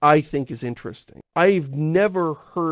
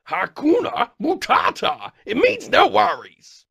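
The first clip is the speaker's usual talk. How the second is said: Pumbaa; 9 semitones higher than usual. Acted. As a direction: belt out a big, drawn-out cartoon catchphrase. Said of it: "I've got Pumbaa from".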